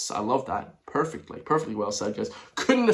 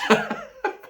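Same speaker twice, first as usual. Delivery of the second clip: shrieking giggle, much higher; high burst of laughter. Real, not acted. Vlogger laughs at a ballot joke about Gavin, high shrieking giggle.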